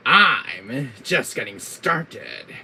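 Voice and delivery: loud, very slow villain boast; hammed-up villain defiance; Wesker. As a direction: stretch it out with menace. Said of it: "my Wesker voice".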